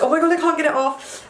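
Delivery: high-pitched mock alarm; retold panic. Hot curry sauce on hand, retold panic.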